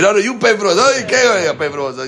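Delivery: high and loud, flatly refusing; screaming refusal. Retold or imitated. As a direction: yell it back at the boat guy. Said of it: "that guy starts screaming".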